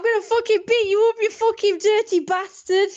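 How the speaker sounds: put-on high, loud posh-accented threat; hammed-up posh husband shouting. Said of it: "he says it in a posh accent".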